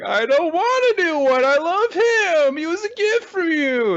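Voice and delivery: put-on very high, whiny child tantrum; wailing little girl. Child refusing dad's new toy, mock wail. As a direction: protest like a heartbroken six-year-old.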